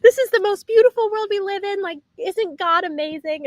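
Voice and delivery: high, bright gushing imitation of Jerry. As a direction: mimic her high excited gush. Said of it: "She's like".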